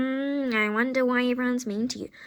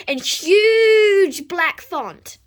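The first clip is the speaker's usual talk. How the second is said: slow, loud and drawn out; shouted for effect; loud emphasis; 6 semitones higher, 9 dB louder, much slower than usual. Acted. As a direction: hammer each word.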